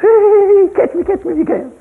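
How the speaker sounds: put-on high, light child's voice; sing-song like a giggling toddler; child teasing grandpa to chase her; playful little girl. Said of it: "and goes".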